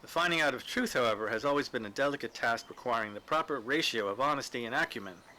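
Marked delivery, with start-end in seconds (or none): none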